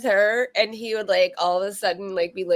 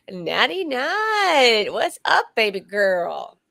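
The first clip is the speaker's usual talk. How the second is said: drawn-out greeting; much slower than usual. Acted. Greeting friend in put-on drawl.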